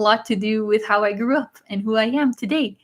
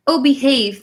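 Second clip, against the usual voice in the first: a light, louder imitation of Goldmember's line; Goldmember catchphrase; 7 dB louder than usual. Streamer quoting Goldmember to chat, lightly imitated. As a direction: toss off the movie catchphrase in a playful put-on voice. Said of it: "What does Goldmember say all the time?".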